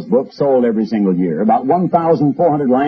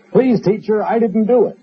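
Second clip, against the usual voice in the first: much higher, pupil's protest to the teacher; pleading schoolboy; 11 semitones higher than usual. Retold or imitated. Son answers the teacher in class, high pleading child voice. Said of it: "his own son, chuckled the fellow".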